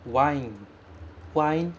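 "wine" ending on the n, no extra i; Japanese wain. Shown as a demonstration. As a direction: model "wine" stopping on the n. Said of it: "esse um que vem no final". ("wine" terminando no n, sem i)

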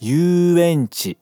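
"Yuenchi" with a stretched, long "yu". Shown as a demonstration. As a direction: draw out the "yu" in "Yuenchi". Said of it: "esticar o som do yu". ("Yuenchi" esticando o som do yu)

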